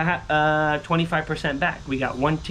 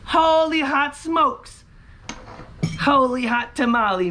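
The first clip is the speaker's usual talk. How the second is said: high, drawn-out cry of amazement; amazed exclamation; 8 semitones higher, slower than usual. Real, not acted. Scratcher marvels at the money bag, amazed cry. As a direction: marvel aloud, pitched high.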